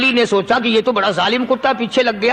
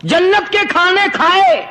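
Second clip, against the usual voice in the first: indignant talking dog; 5 semitones higher than usual. Retold or imitated. Dog rebukes the saint.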